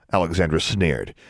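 usual speaking voice

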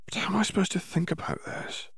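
hushed plea. A narrator's reading. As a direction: breathe it out like a lost student. Hermione pleading quietly.

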